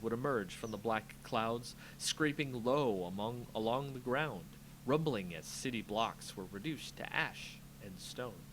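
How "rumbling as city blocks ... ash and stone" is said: plain reading voice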